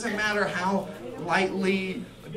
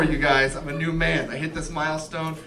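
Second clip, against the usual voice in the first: normal talking voice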